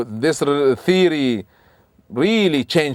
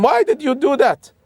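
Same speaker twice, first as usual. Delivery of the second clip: shocked protest; 4 dB louder than usual. Acted. Musa protests the broken ship.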